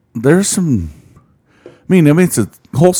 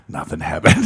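whispered; 5 semitones lower, 3 dB quieter than usual. Pretend. Whispered joke denial.

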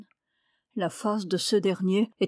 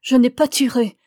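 loud, raised, insistent denial; defiant young woman, each phrase hammered out louder; defiant cry; 3 semitones higher, 7 dB louder than usual. A narrator's reading. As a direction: cry out your innocence with defiance. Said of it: "d'une voix pleine de défi, elle s'écria".

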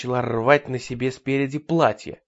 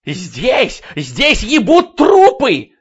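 high, slow hysterical yell; frantic screaming; 11 semitones higher, 12 dB louder, much slower than usual. Acted. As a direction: howl it at the top of your lungs. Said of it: "истошно вопить".

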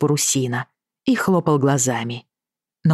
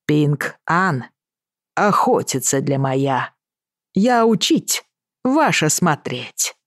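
put-on Chinese accent; slower than usual. Mr Willoughby's broken Russian.

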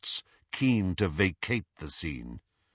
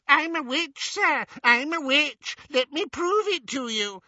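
high squeaky mocking whine; squeaky impersonation; 3 dB louder than usual. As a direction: mimic a pleading woman, squeaky. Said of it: "he said in a squeaky impersonation".

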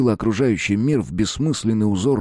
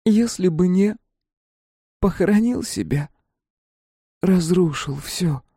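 higher, lightly voiced reproach; a voice bending through cracks; wife's accusing voice from the rubble, lightly voiced; distorted wife's voice; 9 semitones higher than usual. A narrator's reading. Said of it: "Голос искажался в трещинах".

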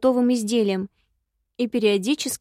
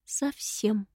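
soft, tired exhale; weary sigh; 8 dB quieter than usual. A narrator's reading. Wife wearily answering her husband, soft and tired. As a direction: breathe the word out, worn down. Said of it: "устало выдохнула я".

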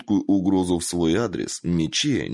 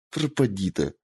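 grumbling. A narrator's reading. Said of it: "буркнула Мичиё".

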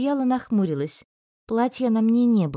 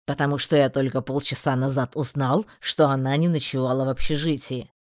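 stern male voice; 7 semitones lower than usual. Acted. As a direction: justify himself sternly. Magistrate answers.

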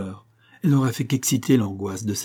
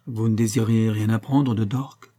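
plain reading voice

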